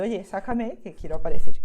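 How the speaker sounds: a playful pet's plea, loud on the end; voicing her bunny. Teacher voices her pet rabbit for the viewers, playful loud plea. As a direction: speak as the bunny begging to be on camera. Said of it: "Me ha estado diciendo".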